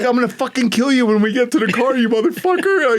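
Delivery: high, loud threatening yell; mock furious shout at his partner. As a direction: scream the threat while running. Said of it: "Yeah, I'm like".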